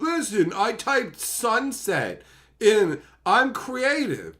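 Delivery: high, drawn-out mock boast; imitating someone smugly proud of little; mock self-important creator. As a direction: boast slowly in a high mocking voice. Mimicking a self-declared creative.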